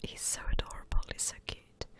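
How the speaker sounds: usual speaking voice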